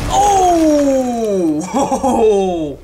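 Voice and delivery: loud, long falling "oh" breaking into laughter; whoop, then laugh it out; big awed holler with a laugh; thrilled whoop. Real, not acted. Reacting to spectacular game moment.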